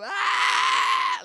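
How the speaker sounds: loud, very high drawn-out jeering "ah"; mocking holler. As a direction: screech in mockery. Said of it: "everybody's making fun of me".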